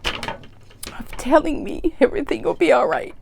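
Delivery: high, tearful voice breaking up; really crying. Real, not acted. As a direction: choke the words out through real tears. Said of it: "I don't know why I'm crying".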